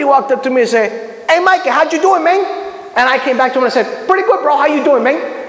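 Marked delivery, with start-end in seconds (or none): 1.3-2.4 put-on high Cuban-accented Miami greeting. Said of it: "friends who are Cuban or whatever". 4.1-5.4 put-on high, Cuban-accented reply. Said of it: "friends who are Cuban or whatever".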